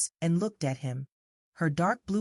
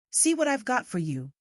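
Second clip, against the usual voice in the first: higher, sing-song coaxing offer; 7 semitones higher than usual. Acted. Lady tempting the crying girl with sixpence, high coaxing. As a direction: tempt the child with a coin.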